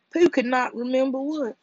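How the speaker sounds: ordinary delivery, nothing marked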